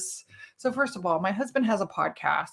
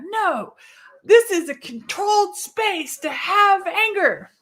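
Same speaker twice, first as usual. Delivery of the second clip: high, emphatic declaration, slow and loud; practicing anger; 9 semitones higher, 4 dB louder, slower than usual. Retold or imitated. Practicing being mad in a controlled space, angry declaration. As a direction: declare it high and forcefully, word by word. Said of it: "I used to be really rageful".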